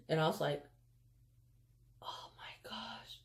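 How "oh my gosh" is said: breathy, panicked hush; whispering so nobody below hears. Retold or imitated. Kid hiding in a tree from the teacher, terrified whisper.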